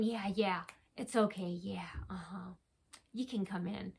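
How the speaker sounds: hushed, mocking whisper of a complicit employee. Mocking the store staff in a hushed whisper. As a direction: whisper it as if secretly waving them in. Mocking businesses letting stalkers in.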